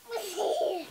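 high-pitched real giggle. Real, not acted. Child's hands in his mouth.

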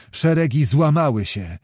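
usual speaking voice

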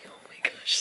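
unvoiced soft whisper. Real, not acted. Mother whispering to her spinning baby, soft whisper. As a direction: hush it in amazement.

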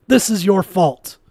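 high, loud blame; furious accusation. A narrator's reading. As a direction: hurl the blame at him. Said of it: "Quan screamed".

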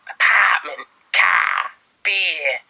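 mock East Coast American word list; American accent. Repeating practice words for viewers, mock American accent. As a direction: put on American vowels, word by word. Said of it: "Someone said do American accents".

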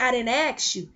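ordinary talk, nothing marked

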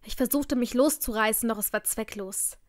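ordinary delivery, nothing marked

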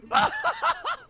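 very high startled cackle; high shocked laugh. Real, not acted. Gamer caught off guard, shocked high laugh. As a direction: burst into a high-pitched laugh.

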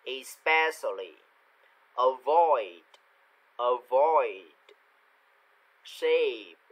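isolated words, carefully articulated with long gaps; pronunciation model reading. Shown as a demonstration. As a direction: read each word out clearly for learners to copy. Models vocabulary for repetition. (đọc mẫu từng từ rõ ràng)

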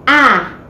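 ah said as a short open a sound; letter sound ah. Shown as a demonstration. Teacher modelling the first sound of apple, a short ah. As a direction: give just the sound ah. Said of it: "the sound ah".

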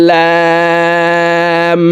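"লাম" with the vowel held very long on a high level tone; four-alif stretch. Shown as a demonstration. Teacher demonstrating lam, long drawn-out hold. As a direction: draw out "লাম" as one steady chanted note. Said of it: "লাম হরফকে চার আলিফ টেনে পড়ব".

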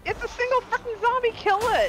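high, fast frantic yell; panicking gamer. Real, not acted. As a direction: scream at them to kill it. Yelling at teammate.

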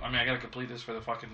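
ordinary delivery

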